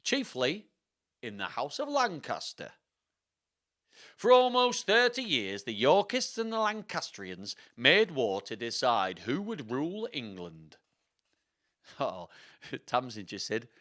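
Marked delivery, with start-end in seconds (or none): 0.0-10.8 Richard the third. Acted. Richard recounting thirty years of war, theatrical accent. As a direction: tell the history as stagey oratory in accent. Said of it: "fun to say in this accent".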